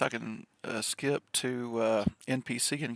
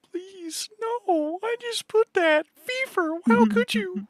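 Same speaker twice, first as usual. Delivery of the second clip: much higher, squeaky pleading voice; mock despair; 8 dB louder, slower than usual. Pleading with the drawing program Fever after almost erasing her work, high and squeaky. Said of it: "I'm like".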